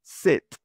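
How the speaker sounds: Set, short open e; clipped English "Set"; English vowel demo. Shown as a demonstration. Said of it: "sino set". (ejemplo de vocal: "Set")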